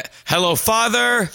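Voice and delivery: high, over-enunciated mock formality; tense Malkovich-style address, word by word; speaking very directly. Child addressing Dad as if deaf, very direct. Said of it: "speaking very directly, like, to a deaf person".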